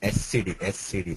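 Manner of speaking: ordinary talk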